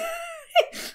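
delighted at her own pairing idea; high squeal of laughter. Real, not acted. Squealing laugh at her own character pairing.

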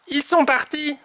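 high character voice, comforting; reassuring Tom. A narrator's reading. Tom says the rabbits are gone, high reassuring voice. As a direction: soothe the friend, pitched high. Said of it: "le rassure Tom".

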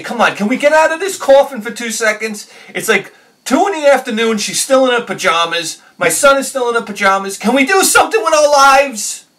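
loud, high exasperated rant; flipping out. Retold or imitated. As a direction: blow up at the family lounging in pajamas. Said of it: "Then I, then I flip out".

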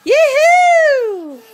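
high, loud, laughing call; delighted sing-song greeting; excited yoo-hoo. Real, not acted. Mum playing with bouncing baby.

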